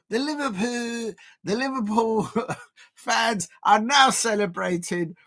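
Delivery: slow, laughing mock Scouse; mock Scouse accent. Commentator mocking celebrating Liverpool fans, in laughing Scouse. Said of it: "I'm doing a better accent than the Liverpool".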